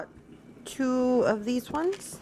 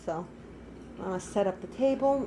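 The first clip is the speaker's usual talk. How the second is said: normal talking voice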